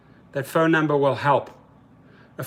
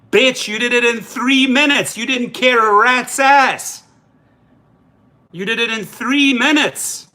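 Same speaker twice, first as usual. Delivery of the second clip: angry rant; 6 semitones higher, 3 dB louder than usual. Real, not acted.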